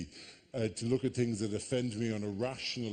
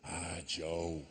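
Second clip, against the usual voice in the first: mumbled Irish caller; 6 semitones lower, 5 dB quieter, much slower than usual. Retold or imitated. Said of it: "it was all very".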